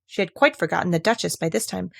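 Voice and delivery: ordinary delivery, nothing marked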